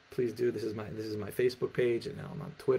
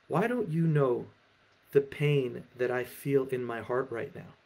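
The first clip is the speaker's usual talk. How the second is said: higher and louder, then slowing into hurt; distraught, worked up; 3 semitones higher, 3 dB louder than usual. Acted. Hurt Ivan confronts his friend about his pain, distraught. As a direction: break out in wounded accusation. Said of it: "so distraught and so worked up".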